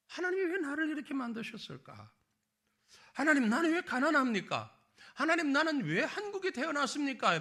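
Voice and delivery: mock-plead with God, half laughing; whining at God. Preacher imitating a believer's complaint to God, whiny and laughing. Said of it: "이렇게 원망할 때가 얼마나 많아요".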